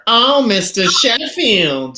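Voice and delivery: high, loud, drawn-out sitcom impression; big high-pitched celebrity mimicry; Fran Drescher. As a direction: wail it slowly in a nanny's put-on voice. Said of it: "it's starring Fran Drescher".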